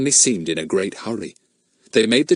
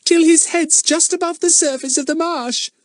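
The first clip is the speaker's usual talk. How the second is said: put-on high, far child voice with a shiver; shivering little girl; 4 dB louder than usual. A narrator's reading. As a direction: Betts frightened, voice pitched up high. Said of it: "said Betts with a shiver".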